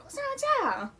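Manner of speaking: high, bright, carefree question as the oblivious best friend; best friend greeting them cheerfully, high and happy. Retold or imitated. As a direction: sing it out happily, not knowing the bad news. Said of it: "she's like".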